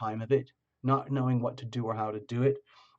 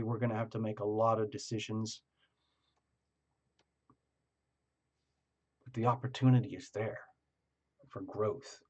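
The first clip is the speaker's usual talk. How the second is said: softer, understated inner voice; quiet and private; 3 semitones lower, 6 dB quieter than usual. Retold or imitated. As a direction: keep it close and personal. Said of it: "keep it very personal and quiet to yourself".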